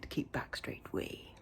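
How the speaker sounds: low, soft, muttered under the breath; low muttered aside. Pretend. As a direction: mutter it to yourself, half mocking. Mock repeat of own plan.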